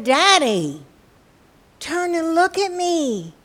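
loud, high, drawn-out plea; upset crying child. Acted. Girl begging her father to face her, crying. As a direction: wail for Daddy's attention. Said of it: "She was still crying, still very upset".

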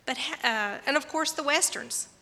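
usual speaking voice

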